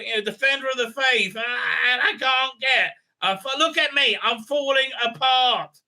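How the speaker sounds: high, slow, whining king voice rising to a cracking shout; a worn-out king begging for a night's sleep; exasperated king. Retold or imitated. King woken by the fight complains, high and whining. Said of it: "so the king's like".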